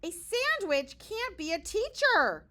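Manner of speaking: loud arguing; not so quietly. Acted. Kids argue loudly about their teacher. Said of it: "then not so quietly".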